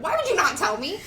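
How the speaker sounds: acting out an indignant question; retold exasperation. Host retells confronting friends about A D H D, exasperated.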